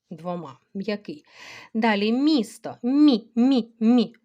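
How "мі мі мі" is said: Мі repeated, louder and higher; loud syllable drill. Shown as a demonstration. Teacher sounds out Мі for pupils. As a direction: call out Мі three times. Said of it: "губний".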